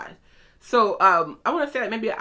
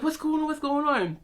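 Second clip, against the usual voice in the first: higher-pitched mock fretting; replaying an inner freak-out; retold panicked thought; 7 semitones higher, 3 dB quieter than usual. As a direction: fret to yourself, high and hushed.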